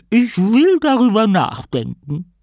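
booming, slow sea queen; evil queen. A narrator's reading. Sinking queen's last words.

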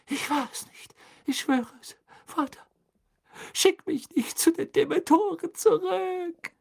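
very high, desperate wailing; crying plea. A narrator's reading. Boy in chains begs his father, crying out high. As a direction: beg in a high, tearful cry. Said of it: "schrie der Junge in Ketten".

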